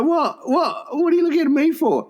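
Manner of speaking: high-pitched, baffled dog voice; clueless dog. Retold or imitated. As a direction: squeak it out as an innocent dog, quick protest. Voicing the innocent dog again.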